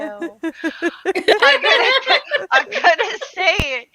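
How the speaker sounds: high, laughing through the words. Real, not acted. Insisting on saying it anyway, laughing.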